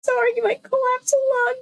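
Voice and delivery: very high, thin squeak; squeaky high voice. Real, not acted. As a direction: squeak it out jokingly. Mock high squeaky aside.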